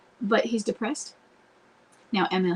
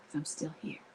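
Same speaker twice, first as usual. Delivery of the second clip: soft whisper, much quieter; 4 semitones lower, 12 dB quieter than usual. Shown as a demonstration. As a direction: whisper it like a promise. Tender promise to husband.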